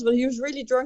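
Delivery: normal talking voice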